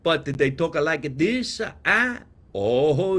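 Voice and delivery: normal talking voice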